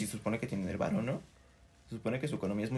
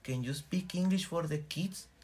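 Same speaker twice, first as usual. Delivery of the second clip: higher, slow, polite tourist English; mock gringo dad; 5 semitones higher, slower than usual. Tourist dad asking the dolphin trainer for English, mock high slow. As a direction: ask sweetly for English, slowly.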